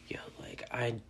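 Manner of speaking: brief whispered quote opener. Retold or imitated. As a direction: breathe it as a whisper. Quoting herself, hushed.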